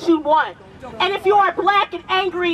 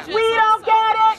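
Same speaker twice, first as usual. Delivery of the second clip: shouted chant call; shouted rally call awaiting response; shouted protest call; 3 semitones higher than usual. Real, not acted. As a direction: yell the call to the crowd.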